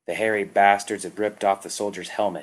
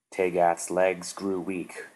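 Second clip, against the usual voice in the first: usual speaking voice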